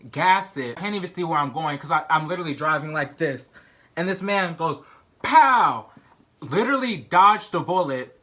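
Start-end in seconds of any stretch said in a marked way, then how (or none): 5.2-5.9 a sharp, loud, high "pow!" gunshot noise; imitated gunshot. Mimicking the man firing at her car, a loud "pow!". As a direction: punch out a mock gunshot.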